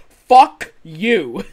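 loud burst of a swear; shouted curse. Retold or imitated. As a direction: yell it out. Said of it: "he has a chance of just being like".

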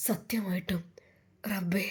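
hushed whisper to God; whispered prayer. Acted. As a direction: breathe the words to Allah, no voice. Old woman prays in shock.

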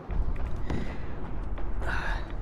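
a long unvoiced yawning sigh; big yawn. Real, not acted. Gamer yawning mid-play, long breathy yawn. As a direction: let out a tired yawn. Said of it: "I don't know why I'm yawning".

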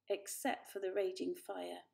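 plain reading voice

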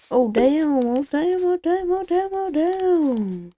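rising disbelief, slowly stretched; building excited exclaim. Real, not acted. Reacting to a game surprise.

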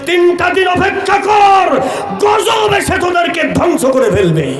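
high, shouted warning of doom; finger-wagging threat. Acted. Prophet warning the leaders of punishment, angry high shout. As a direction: threaten them in an acted, high-pitched shout. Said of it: "নেতাদেরকে আঙুল নাচায় বললেন".

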